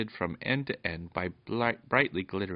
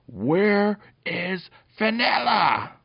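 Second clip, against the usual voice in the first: very high, slow, drawn-out yell; full yell; 13 semitones higher, 8 dB louder, much slower than usual. A narrator's reading. Peter yells in the goblin dog's face, full yell. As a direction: yell each word at full voice. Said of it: "and yelled at him".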